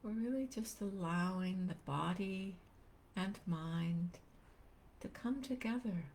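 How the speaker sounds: unhurried meditation guide, long pauses between phrases; calm, spacious guiding voice; guided meditation. Real, not acted. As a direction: gently lead listeners into stillness, leaving space after each phrase.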